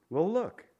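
usual speaking voice

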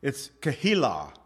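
Keilah said as "keh-HEE-lah", stress on HEE; Hebrew pronunciation. Shown as a demonstration. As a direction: Keilah with a breathy h in the middle, kuh-HEE-lah. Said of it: "now this is the Hebrew pronunciation".

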